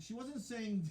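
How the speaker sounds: ordinary delivery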